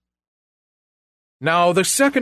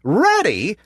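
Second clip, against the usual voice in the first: high eager pig question; little pig voice; 8 semitones higher, 3 dB louder than usual. A narrator's reading. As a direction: ask brightly, curious. Pig teases wolf.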